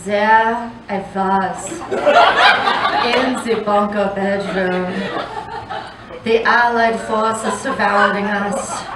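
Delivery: slow, hushed romance-novel telling; mock-dramatic narration. Comic spinning her past-life fantasy, lightly hushed. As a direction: set the scene like a steamy paperback.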